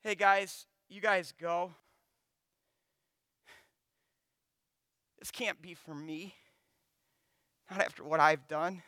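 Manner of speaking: a hint of a beaten man sending friends away; dejected Peter. Peter to his friends, quiet and resigned. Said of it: "hear him say".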